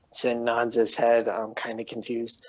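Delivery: normal talking voice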